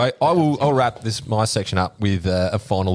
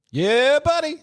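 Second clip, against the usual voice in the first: high hyped-up "yeah buddy"; excited; slower than usual. Acted. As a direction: call it out like a pumped-up mate. Hyped catchphrase about gun buddies.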